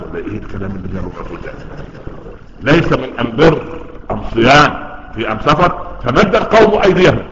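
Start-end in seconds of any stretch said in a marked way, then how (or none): 2.7-5.9 am- replacing al- in أم بر, أم صيام, أم سفر; Himyar dialect article. Acted. Teacher repeats the Prophet's Himyar sentence, am- for al-. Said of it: "هي نطق الألف لام ألف ميم".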